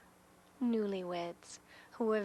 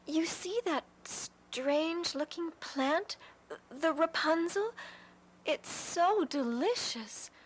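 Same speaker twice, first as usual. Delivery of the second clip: higher, slow dreamy craving; longing wife; 8 semitones higher than usual. Acted. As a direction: savour the word delicious. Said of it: "the wife said".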